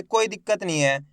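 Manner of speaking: usual speaking voice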